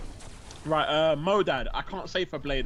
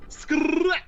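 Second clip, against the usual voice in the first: high, loud rolled "Skrrrrap" sound, meme ad-lib style; ad-lib "skrrap" imitation; 5 dB louder than usual. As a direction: mimic the kid's rapper ad-lib with a rolled r. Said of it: "my son goes".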